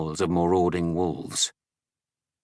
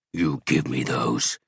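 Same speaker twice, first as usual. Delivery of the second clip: low, slow snarl at Barbara; 7 semitones lower, slower than usual. A narrator's reading. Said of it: "snarled Vasor".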